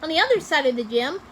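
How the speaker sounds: ordinary delivery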